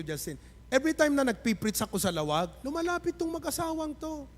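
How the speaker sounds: usual speaking voice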